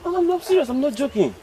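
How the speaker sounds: high-pitched insistence; high, pleading insistence; earnest protest. Acted. Insisting to doubting workmate.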